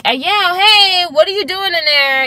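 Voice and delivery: mock calling out to the bathroom. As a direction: call out impatiently through the door. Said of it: "I'm like".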